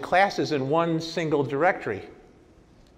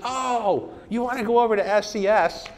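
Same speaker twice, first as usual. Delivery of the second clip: higher, light helpful voice for the consultant; lightly voiced kid; 6 semitones higher than usual. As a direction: play the eager young helper, a bit higher. Consultant kid's helpful reply.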